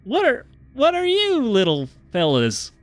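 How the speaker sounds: high, folksy drawl of a too-friendly stranger. Acted. GM voicing the friendly stranger to the Lumpen, high folksy howdy. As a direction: greet them like a smiling cowboy.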